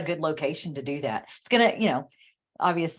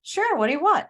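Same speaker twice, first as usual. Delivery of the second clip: high, bright-eyed waiter voice, much higher; eager waiter; 12 semitones higher than usual. Retold or imitated. Storyteller voicing the waiter offering anything. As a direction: play the eager beach waiter. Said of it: "he goes".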